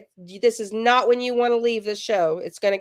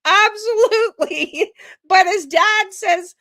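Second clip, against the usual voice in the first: laughing; 8 semitones higher, 6 dB louder, slower than usual. Real, not acted. Laughing at the lies.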